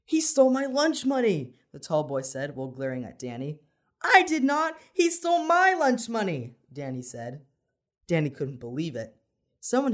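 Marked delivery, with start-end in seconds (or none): 0.1-1.6 put-on high, loud kid tattling; loud accusation. A narrator's reading. Tall boy glaring and blaming classmate, loud high accusation. 4.0-6.6 loud, very high protest, MY stressed; indignant denial. A narrator's reading. Accused boy defending himself to teacher, indignant with stress on "my".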